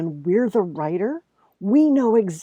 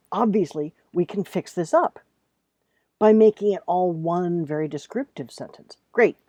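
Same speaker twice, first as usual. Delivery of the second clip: normal talking voice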